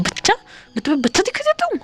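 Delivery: high, fast offended outburst; angry retort. Acted. Wing objects to Wang Ji calling him baby, high and indignant. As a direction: snap back, insulted at being called a kid. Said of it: "बहुत गुस्से में देखता है".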